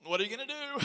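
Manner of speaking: put-on high, loud alarmed kid; worried little boy. Son asks dad at the mirror, alarmed kid voice. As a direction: squeal it like a scared child.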